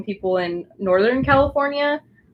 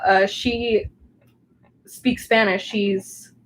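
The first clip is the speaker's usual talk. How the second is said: normal talking voice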